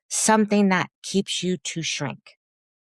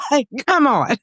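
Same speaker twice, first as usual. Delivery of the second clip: laughing; 13 semitones higher, 4 dB louder than usual. Real, not acted. Laughing exasperation.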